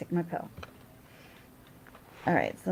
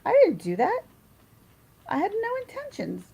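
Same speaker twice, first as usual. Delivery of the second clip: high-pitched mock protest; protesting friend; 12 semitones higher, 6 dB louder than usual. Friend denying blame to the toddler's mother, high mock protest. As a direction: plead innocence in a high, put-on voice.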